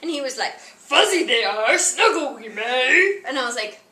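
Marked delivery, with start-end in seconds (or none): 0.6-3.2 slow, louder Yoda croak; mock Yoda reply, unhurried. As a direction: drag out each word like Yoda. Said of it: "he would not stop talking like Yoda".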